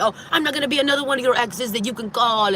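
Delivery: a hint of a sneering ex-boyfriend; mocking ex. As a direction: parody him whining about being another ex. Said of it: "so he's making fun of me".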